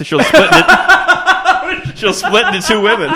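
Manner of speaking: breaking up mid-sentence, slowed by laughter; laughing. Real, not acted. Podcaster cracking up at her own joke, laughing. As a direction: laugh through the punchline.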